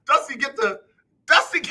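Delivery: loud high laughter through the words; laughing. Real, not acted. Streamer cracking up at a viewer's Dusty Rhodes pick, loud laughing. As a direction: laugh out the name.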